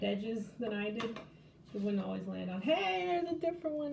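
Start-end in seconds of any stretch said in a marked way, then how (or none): 2.6-3.9 surprised. Real, not acted. New symbol finally comes up.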